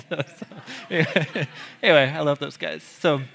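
a light laugh through "anyway"; chuckling while getting back on track. Real, not acted. Laughs winding down the digression.

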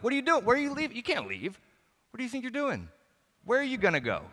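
high-pitched, incredulous pleading; panicked protest. Acted. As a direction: protest in a high voice, as if being abandoned. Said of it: "It was more of like".

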